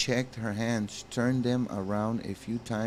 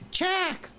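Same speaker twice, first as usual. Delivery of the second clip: high call of his name. Acted. Grace calling out to Jack, high call. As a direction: call him across the room.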